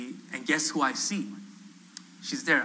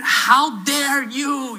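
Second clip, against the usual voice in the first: high, loud, drawn-out mock outrage; acted indignant fury, very slow; fuming; 9 semitones higher, 6 dB louder, much slower than usual. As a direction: imitate a scandalised woman scolding, stretching each word. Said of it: "fuming at me".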